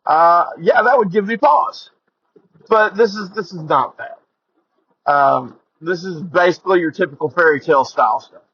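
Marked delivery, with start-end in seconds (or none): 3.3-5.1 slow, deliberate stress on not; emphatic denial. Real, not acted. Host reassuring listeners, slowly stressing "not". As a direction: lean hard on "not", drawn out slowly.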